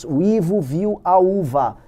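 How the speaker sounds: "O Ivo viu a uva" read slowly, syllable by syllable; sounding out words. Shown as a demonstration. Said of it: "Tinha aquelas frases idiotas".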